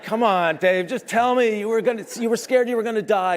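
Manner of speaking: high, loud laughing plea; high-pitched excited begging; pleading. Retold or imitated. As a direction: beg him to admit he was scared, laughing.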